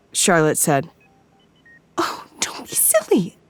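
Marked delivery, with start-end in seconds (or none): 2.0-3.4 higher, thinner, nervous. Acted. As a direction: brush it off, too high. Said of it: "higher and thinner than normal".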